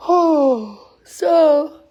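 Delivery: loud yawn through the words. Real, not acted. Apologizing to viewers, a yawn. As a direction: talk through a big yawn. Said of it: "I'm so sorry I'm yawning".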